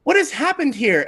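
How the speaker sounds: party lady. Acted. Party-dressed lady asking the heroes, high and prissy. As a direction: ask it like a fussy lady. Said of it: "and she says".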